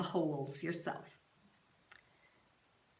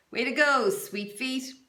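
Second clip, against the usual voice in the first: cheering teammate; 6 semitones higher, 7 dB louder than usual. A narrator's reading.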